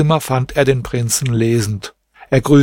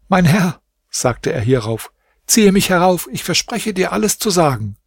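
high pleading from down in the well; a servant's high, frightened begging; desperate plea; 6 semitones higher than usual. A narrator's reading. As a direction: beg to be pulled up, voice pitched higher.

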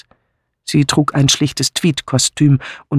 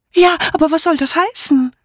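much higher, anxious asking; fluttery Amy. Acted. Amy pressing Martha, high and anxious. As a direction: ask high and flustered.

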